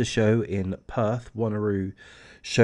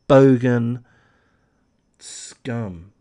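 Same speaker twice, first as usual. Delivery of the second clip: spat insult; 6 dB louder, much slower than usual. Real, not acted. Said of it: "angry insult".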